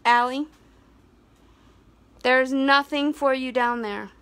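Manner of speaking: loud call across the yard. Real, not acted. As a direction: raise the voice to reach the dog. Calling dog from distance.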